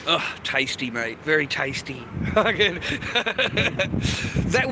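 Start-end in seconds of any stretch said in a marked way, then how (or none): 2.2-4.7 breaking up laughing mid-word; higher, laughter-broken words; real laughing. Real, not acted. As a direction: crack up at your own crude joke. Cracking up at own crude joke.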